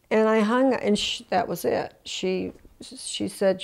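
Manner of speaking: ordinary talk, nothing marked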